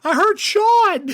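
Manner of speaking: high, loud, drawn-out mocking echo; teasing echo. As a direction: throw the slip back at him slowly. Teasing echo of friend's slip.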